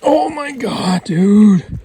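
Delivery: real ecstatic shout; excited shout. Real, not acted. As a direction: let it burst out at the buck. Hunter reaches the huge buck.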